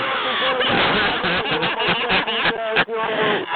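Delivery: bursts out laughing mid-roast; a high, breathy burst of real laughter. Real, not acted. Roasting caller, cracks up.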